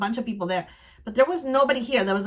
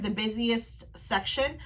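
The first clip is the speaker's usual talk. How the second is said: normal talking voice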